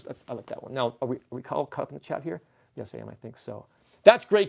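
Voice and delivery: ordinary delivery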